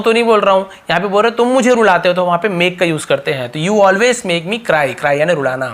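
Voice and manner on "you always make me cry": each word drawn out; slow model sentence. Shown as a demonstration. Teacher slowly repeating the sentence to learners.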